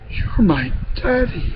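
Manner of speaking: put-on high, soft, slow child's wonder; dawning amazement. A narrator's reading. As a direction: realise it in hushed awe.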